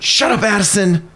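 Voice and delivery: high-pitched mock scolding; teasing. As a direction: playfully shush an excited student. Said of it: "teasing her".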